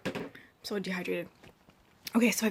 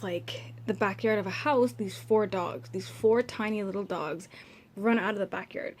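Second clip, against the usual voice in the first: normal talking voice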